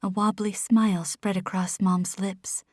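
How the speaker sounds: ordinary delivery, nothing marked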